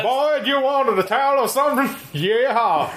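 put-on high, cowboy-style American accent. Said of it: "But in an American accent".

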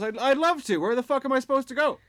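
high, loud, exasperated chicken voice. Retold or imitated. Chicken protesting to the barman, high and loud. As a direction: squawk it out like a desperate little bird. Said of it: "And the chicken goes".

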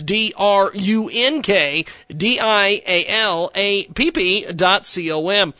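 slow, letter by letter spelling; spelled out slowly. Shown as a demonstration. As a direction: spell each letter out clearly. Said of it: "drunk dial app dot com".

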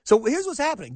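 normal talking voice

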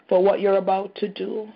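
ordinary delivery

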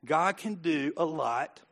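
slow, soft and deliberate, each word weighed; slowed right down. Shown as a demonstration. Preacher slowing down to calm the crowd, soft and slow. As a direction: ease off and let every word land slowly. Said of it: "Slow it down".

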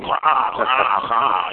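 imitating a huge crow cawing; rough imitation of an outsized crow; giant crow call. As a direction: caw like a very heavy crow. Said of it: "like an eight hundred pound crow going".

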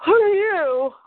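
breathless, stunned question; gasping in shock. Retold or imitated. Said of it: "And Clark's gasping".

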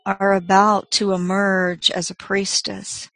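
slow, soft meditation voice; guided meditation. Acted. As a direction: gently guide them inward, unhurried.

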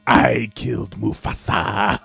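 low, slow theatrical villain impression; hammy, deep-voiced villain confession; Jeremy Irons. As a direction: draw it out like a grand, sinister villain. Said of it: "No, I'm Jeremy Irons. This is how I talk".

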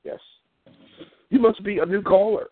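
ordinary talk

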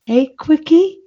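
put-on high, loud, halting Scouse child accent; slow Scouse attempt, pitched high with pauses between phrases; Scouse kid attempt. Shown as a demonstration. Trying the advert line on request, high halting Scouse. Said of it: "there was a Scouse kid on it".

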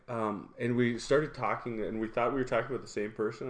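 ordinary talk, nothing marked

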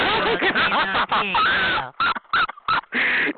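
high, drawn-out cackle; long laugh. Real, not acted. Taunting rival about smoking, long high cackle. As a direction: crack up at your own taunt.

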